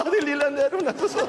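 high mock cooing into laughter; kælent efterlignet latter. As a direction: gush in a high voice, then cackle along. Mimics family cooing, then laughing. (hånligt efterlignet begejstring med latter)

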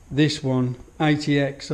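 normal talking voice

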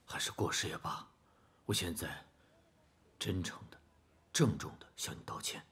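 soft, hushed, earnest plea; quiet apology. Acted. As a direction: apologize softly, nearly whispering. Father's hushed, earnest apology to son.

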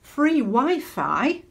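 slow, high and loud Free Wi-Fi; reading the sign. Retold or imitated. Preacher reading the real sign, slow and loud. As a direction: drag out "Free Wi-Fi" as the reveal. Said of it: "It read".

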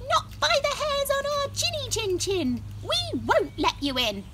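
high squeaky pig; little pig voice. Acted. Pig answers wolf.

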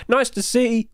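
breathless and strained; squeezed out on the last of the breath; running out of air. Real, not acted. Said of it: "I'm running out of breath".

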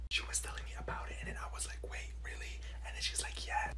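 hushed, voiceless chatter; whispered gossip. Acted. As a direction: whisper gossip to a classmate during class. Pupil gossiping during class.

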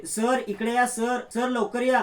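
high, loud, slow calls for sir; shouting for help. Acted. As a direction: yell urgently for the boss to come. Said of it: "जोरजोरात ओरडायला लागते".

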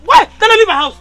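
loud, high, tearful shouting; crying, shouting rage. Acted. Wife cries and shouts at husband to leave the house. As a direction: wail it out through tears.

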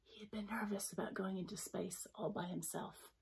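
hushed secret whisper. A narrator's reading.

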